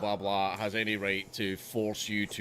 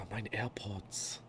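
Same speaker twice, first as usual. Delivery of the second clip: whispered under breath; 8 dB quieter, much slower than usual. Real, not acted. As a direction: whisper it in dismay. AirPods dropped, muttered.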